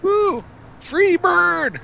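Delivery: high whooping shout; concert crowd yell. Pretend. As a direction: yell it like a rock fan. Concert heckle shout for song.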